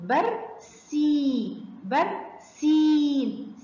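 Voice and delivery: "برسيم" very slow, بر then held سيم; teacher sounding out "برسيم"; long vowel. Shown as a demonstration. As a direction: stretch the ee in "برسيم". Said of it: "سامعين صوت المد بالياء مع السين".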